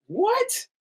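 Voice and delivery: very high shocked "what". Retold or imitated. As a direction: hit it with total shock. Friend shocked.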